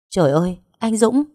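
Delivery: mild narrated scream of dread; horrified cry. A narrator's reading. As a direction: cry out his name in horror. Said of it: "Hạnh kinh hoàng hét lên".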